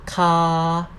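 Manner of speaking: "ka" held level at mid pitch. Shown as a demonstration. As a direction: sustain "ka" on one even tone. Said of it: "no tone mark represent the mid tone".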